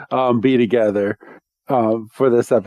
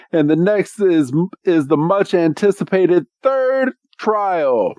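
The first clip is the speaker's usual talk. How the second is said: Wickstrom announcing; 5 semitones higher than usual. Retold or imitated. Trial master unveiling the third trial, mock-grand announcement. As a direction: build up to the big reveal.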